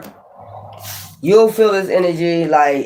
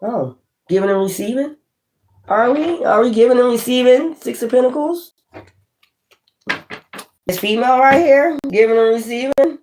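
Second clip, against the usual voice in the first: surprised; 7 semitones higher than usual. Real, not acted. Surprised by the Six of Pentacles card.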